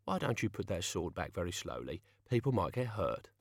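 soft, quietly menacing voice; Albert. Acted. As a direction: warn him calmly at his ear.

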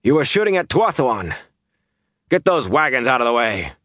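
high, urgent acted command; angry orders. A narrator's reading. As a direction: order the men sharply into action. Angry orders to the armed men.

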